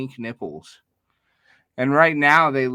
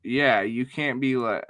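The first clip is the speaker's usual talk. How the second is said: ordinary talk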